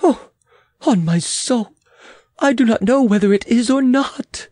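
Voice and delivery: mildly anguished groan. Acted. Queen torn over the proposal, groaning. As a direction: groan out the doubt, pitch climbing. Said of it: "groaned the Queen".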